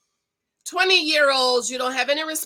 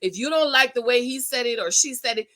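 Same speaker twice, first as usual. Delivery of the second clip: usual speaking voice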